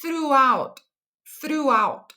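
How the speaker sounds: teacher gives "throughout" twice for the class; model word. Shown as a demonstration. Teacher models "throughout" twice for students. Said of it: "And the last word we have".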